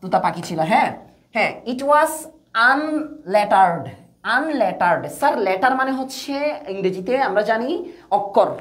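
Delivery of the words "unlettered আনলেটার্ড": "unlettered" broken in two, drawn out slowly; slow word modelling. Shown as a demonstration. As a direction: say "unlettered" slowly, pausing after "un". Teacher models a new word. (ধীরে ভেঙে "unlettered" উচ্চারণ)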